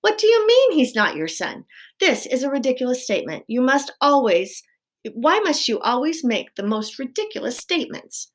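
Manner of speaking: high, loud wifely protest; indignant scolding. A narrator's reading. Wife quarrels with husband.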